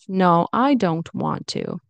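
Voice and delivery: slow, firm refusal; aggressive. Shown as a demonstration. Teacher demonstrating a refusal that sounds mean, aggressive. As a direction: come across mean, each word fully pronounced. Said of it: "That could be aggressive".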